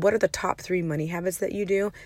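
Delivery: ordinary delivery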